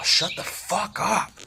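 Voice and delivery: high, strained yell at a dog. Retold or imitated. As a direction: shout it out at the yapping dog. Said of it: "It's about to yell".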